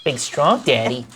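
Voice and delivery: creepy sleazy voice. Acted. Sleazy character flirting.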